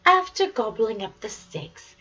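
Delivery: ordinary delivery, nothing marked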